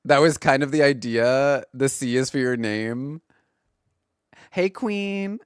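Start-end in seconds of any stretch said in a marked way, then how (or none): 4.5-5.4 high, sassy drag-queen greeting; sassy hey queen. Shown as a demonstration. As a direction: sing it out fierce and high. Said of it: "it sounds like I'm saying".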